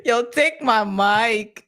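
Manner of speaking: ordinary delivery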